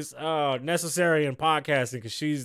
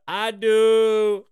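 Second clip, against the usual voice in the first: quoted admission; 5 semitones higher, 8 dB louder than usual. Retold or imitated. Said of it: "As she laughed and admitted".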